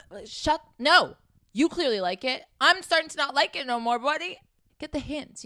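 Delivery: exasperated. Real, not acted. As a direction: push back, fed up. Exasperated reply to the guy.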